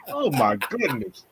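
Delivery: soft laugh through the words. Real, not acted. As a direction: laugh at yourself, quietly. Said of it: "laughing at own slip".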